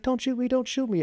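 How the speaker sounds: very high, pleading imitation of a scared man; terrified begging. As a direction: squeal it out like he's begging for his life. Suspect begs at gunpoint.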